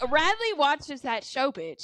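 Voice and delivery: normal talking voice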